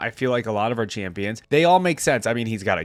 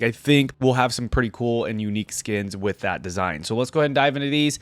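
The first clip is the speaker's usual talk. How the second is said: normal talking voice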